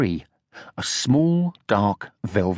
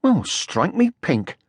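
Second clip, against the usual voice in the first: exclaiming; 7 semitones higher, slower than usual. A narrator's reading. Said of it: "exclaimed Moomin Troll".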